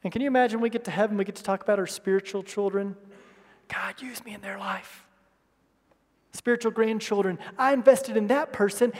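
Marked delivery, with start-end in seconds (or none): 3.7-5.1 hushed, breathy prayer; whispered prayer. Retold or imitated. Believer praying for spiritual children, whispered. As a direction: pray it softly under your breath.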